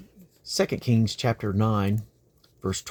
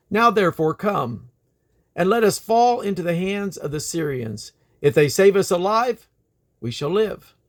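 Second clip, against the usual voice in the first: lively, dramatic Bible reading; earnest storytelling scripture read; 6 semitones higher, 6 dB louder than usual. Acted. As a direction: tell the story with feeling, the king and the woman crying out. Reads scripture aloud.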